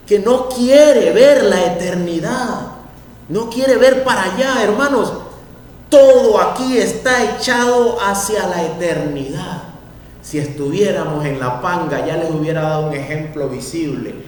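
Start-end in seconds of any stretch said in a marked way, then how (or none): 0.1-9.6 higher, louder fervent preaching; preaching at full force. Real, not acted. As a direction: lift the church toward eternity. (predicando con fuerza)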